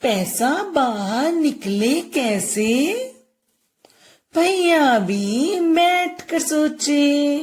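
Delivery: put-on slow singsong rhyme for children; lilting, very slow teacher's recitation; poem recitation. Shown as a demonstration. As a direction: draw out each word of the verse. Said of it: "ए की मात्रा की कविता करेंगे".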